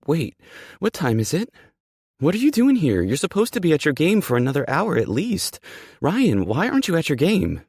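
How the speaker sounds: high, loud, panicked questioning; frantic high-pitched alarm. Acted. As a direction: fire off questions in rising panic. Character panics at unexpected early return.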